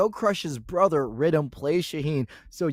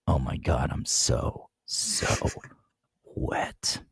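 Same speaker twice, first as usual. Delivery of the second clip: breathy sultry whisper; 13 semitones lower, 5 dB quieter, slower than usual. Pretend. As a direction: whisper it low and slow. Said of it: "talks like this".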